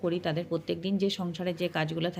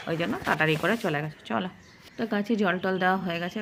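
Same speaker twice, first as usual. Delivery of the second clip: ordinary talk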